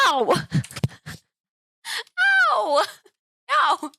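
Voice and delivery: high, loud yelping shout at the cat; real cry of pain, voice cracking up high; startled high shriek at the cat. Real, not acted. Cat attacking her, pain.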